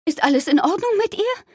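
very high-pitched called question; calling from afar. A narrator's reading. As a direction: call over anxiously from a safe distance. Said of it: "rief Daddys Mutter aus sicherer Entfernung". (hohes Rufen aus der Entfernung)

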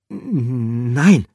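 high, loud stuttered "N-, nein"; stammering. Acted. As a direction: stumble over the no. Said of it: "stotterte Pikandou".

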